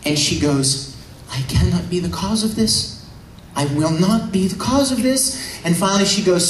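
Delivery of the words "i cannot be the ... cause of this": high, soft, anguished protest; acted distress. Android girl watching two men fight, acted anguish. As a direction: voice a torn girl's mounting distress.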